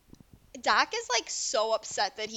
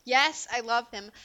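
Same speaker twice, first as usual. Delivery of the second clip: ordinary talk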